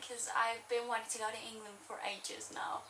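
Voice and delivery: whispered aside; soft whispered explanation. Real, not acted. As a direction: hush it under breath. Aside after quote.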